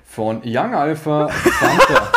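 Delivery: breaks into high, loud laughter; joking answer that dissolves into laughter; laughing joke. Real, not acted. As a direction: fake answer collapsing into laughs. Joking fake answer, then laughing.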